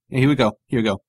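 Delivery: ordinary talk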